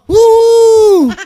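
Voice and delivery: loud, high drawn-out woo; long, high-pitched mock whoop; mock cheer. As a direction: whoop in exaggerated admiration. Mock cheering the senator's speech.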